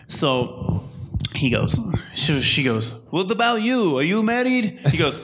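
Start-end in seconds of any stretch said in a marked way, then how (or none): 3.1-4.8 high-pitched mock Russian accent; comic Russian-accented question, high voice; older Russian woman. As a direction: imitate an eager Russian barber, pitched high. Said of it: "this older Russian, obviously Russian woman".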